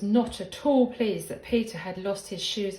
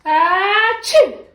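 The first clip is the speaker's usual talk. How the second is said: loud, high mock sneeze; hammed-up storybook sneeze, rising and bursting; big rabbity sneeze; 10 semitones higher, 14 dB louder than usual. A narrator's reading. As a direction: build up to an explosive "achoo". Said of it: "he heard a big rabbity sneeze".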